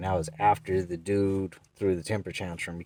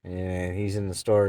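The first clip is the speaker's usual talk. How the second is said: ordinary talk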